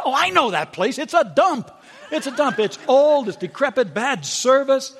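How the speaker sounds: high, dismissive stylist running everything down; scoffing stylist. Retold or imitated. Stylist mocking her trip, high and scornful. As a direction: sneer at every choice she names. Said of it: "He said".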